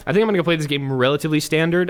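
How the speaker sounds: ordinary talk, nothing marked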